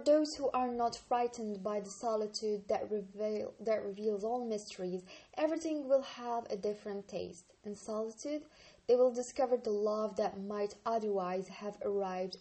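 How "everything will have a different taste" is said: plain reading voice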